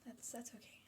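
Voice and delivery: soft whispered reassurance. Real, not acted. Whispering to Andy after he calls himself an asshole. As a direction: breathe it out, almost voiceless. Friend teasing Andy gently.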